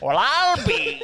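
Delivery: high, drawn-out Southern exclamation; Southern accent. Shown as a demonstration. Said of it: "do a Southern accent".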